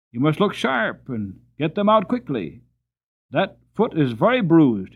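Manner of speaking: loud lecturing farmer; contemptuous. A narrator's reading. Farmer loudly lecturing the driver on stones. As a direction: set the driver straight with scorn. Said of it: "rather contemptuously".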